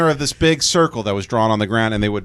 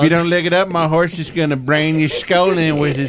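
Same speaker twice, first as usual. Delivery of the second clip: threatening cowboy; 5 semitones higher than usual. Acted. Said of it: "the cowboy just looks at you".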